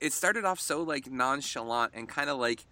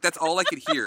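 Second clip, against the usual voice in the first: normal talking voice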